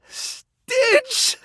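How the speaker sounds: very high, squealed name; joyful recognition. Acted. Recognizing long-lost Stitch, my little troublemaker, overjoyed. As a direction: cry out the name in delighted shock.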